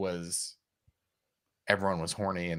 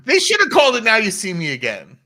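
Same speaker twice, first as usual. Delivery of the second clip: high, loud triumphant punchline; crowing over a pun; gleeful joke; 12 semitones higher, 12 dB louder than usual. Real, not acted. Co-host topping the title joke, high loud glee.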